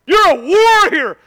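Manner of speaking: slow, loud, booming "You're a warrior."; mock acclaim. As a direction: proclaim it like a battle cry. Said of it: "they go".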